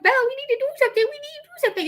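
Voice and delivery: high, fast, sing-song call to the dog; imitating mom calling.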